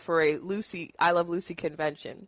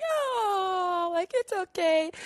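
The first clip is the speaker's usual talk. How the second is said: lightly voiced soothing; 10 semitones higher, much slower than usual. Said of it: "He's like".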